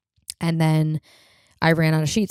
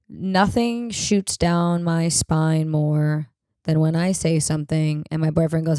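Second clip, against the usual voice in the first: ordinary delivery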